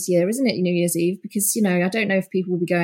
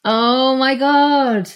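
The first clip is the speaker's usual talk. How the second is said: slow, drawn-out amazement; amazed; 4 semitones higher, 4 dB louder, much slower than usual. Real, not acted. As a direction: stretch it out, delighted and stunned. Laughing reaction to a punchline.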